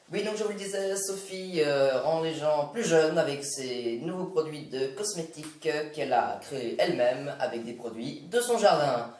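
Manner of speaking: TV news presenter voice; smooth evening-news read; news anchor parody. Acted.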